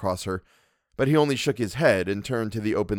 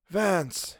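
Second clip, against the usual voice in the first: put-on high, slow, hushed old man's voice; a wounded old man, weak and bitter; Mister Wilde; 9 semitones higher than usual. A narrator's reading. Wilde complaining to the narrator, high and hushed. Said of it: "he read aloud".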